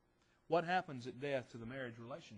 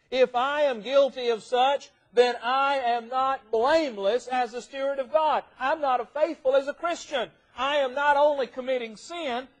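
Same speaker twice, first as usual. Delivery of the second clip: loud, high, deliberate pulpit delivery; full-force preaching; 11 semitones higher, 15 dB louder than usual. Real, not acted. Preacher confessing what sin would make him, full-force preaching. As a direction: thunder it out from the pulpit.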